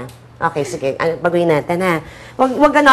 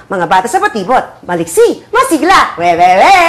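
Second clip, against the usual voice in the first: mock Batibot; 5 dB louder than usual. Director mimicking the actress's sing-song tone, mock Batibot delivery. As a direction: parody a children's show jingle, punching the end. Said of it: "you sounded like a batibot eh".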